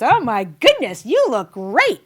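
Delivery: gushing husband. Acted. Husband sees his dressed-up wife, gushing. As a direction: gush in mock-husband amazement.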